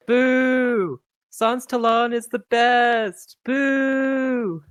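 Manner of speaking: high, loud jeering boos; booing crowd. Acted. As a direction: heckle the announcer angrily.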